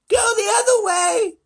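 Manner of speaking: high, loud shriek; yelling grandma. A narrator's reading. Grandma Georgina yells at Wonka to reverse, high shriek. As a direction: bark it in panic. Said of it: "yelled Grandma Georgina".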